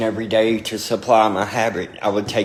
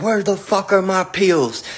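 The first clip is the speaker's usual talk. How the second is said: higher-pitched imitation of an angry dad; pissed-off dad; 8 semitones higher than usual. Dad demands his missing pills from his son, pissed off. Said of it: "So he was pissed".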